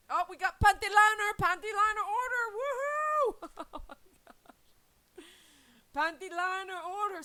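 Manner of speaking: high, loud gleeful whoop; excited cheer. Real, not acted. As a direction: celebrate a new order.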